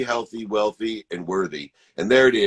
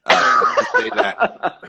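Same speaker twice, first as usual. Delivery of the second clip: laughing; 13 semitones higher, 5 dB louder, slower than usual. Real, not acted.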